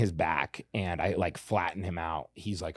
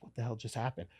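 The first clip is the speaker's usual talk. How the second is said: hushed disbelief, retold; stunned aside; 10 dB quieter, faster than usual. Fighter recalls his shock at what just happened, hushed disbelief. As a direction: drop it to a soft, stunned thought.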